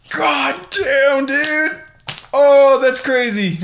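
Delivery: high, loud, drawn-out disbelief; real amazement, voice leaping up; astonished outburst. Real, not acted. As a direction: cry it out, floored and laughing. Shocked at drawn swords.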